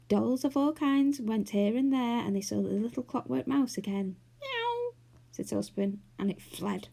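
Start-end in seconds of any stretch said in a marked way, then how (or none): 4.4-4.9 high mock meow; cat meow. A narrator's reading. Saucepan mewing at the clockwork mouse. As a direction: mew like a cat to scare it.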